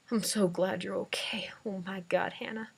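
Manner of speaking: soft whispered relief; relieved whisper. A narrator's reading. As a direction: whisper it into her hair while hugging. Dad relieved, hugging her.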